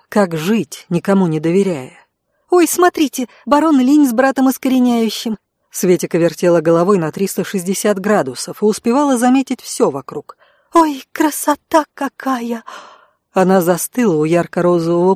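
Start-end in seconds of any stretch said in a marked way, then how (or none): 2.5-5.4 put-on high, eager young girl; bright girlish exclamation, pitched up; girl's voice. A narrator's reading. 10.7-12.6 put-on high, eager young girl; bright girlish exclamation, pitched up; girl's voice. A narrator's reading.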